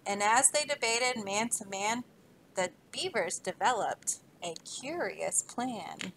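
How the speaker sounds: put-on rhythmic read-aloud to children; story reading. Teacher reading a rhyming book to kids, lively. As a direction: read the rhyme to a class, bouncing along.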